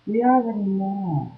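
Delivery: soft, far-off son's voice, lightly done; son's quiet reply. Retold or imitated. Son telling his mom they already know, quiet and calm. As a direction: say it softly, matter-of-fact. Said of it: "my son turns to me and says".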